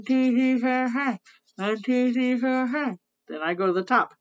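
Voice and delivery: talking while brushing teeth; toothbrush in mouth. Real, not acted. Seen 2: toothbrush held in mouth, brushing, during counting.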